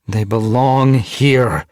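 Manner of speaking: slow, loud, high-pitched insistence; growled. A narrator's reading. Mothwing defends her clan to Lizardtail, growling. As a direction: growl it, drawing out each word. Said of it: "Mothwing growled".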